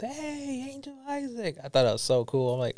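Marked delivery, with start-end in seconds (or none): 0.0-1.6 high, hushed imitation of a distant yell; mock yell. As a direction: mimic someone hailing you, pitched up but soft. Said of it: "somebody just yelled".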